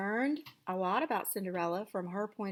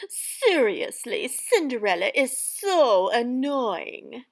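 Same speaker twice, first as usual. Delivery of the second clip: louder, a hint of a sneering stepmother; wicked stepmother voice; 3 semitones higher, 10 dB louder, slower than usual. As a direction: read the title as the wicked stepmother. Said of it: "like I'm the wicked stepmother".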